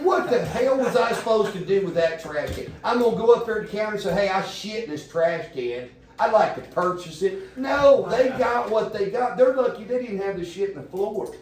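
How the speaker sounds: high, loud, exasperated outburst; indignant retort. Retold or imitated. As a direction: blast back at your wife in disbelief. Retold angry reply to wife.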